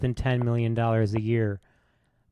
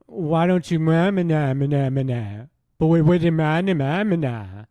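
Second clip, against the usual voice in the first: mock whiny gibberish imitation, raised pitch and volume; пародия на Билла Бёрра; 3 semitones higher, 4 dB louder than usual. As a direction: mockingly babble his response as loud nonsense. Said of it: "And his response was".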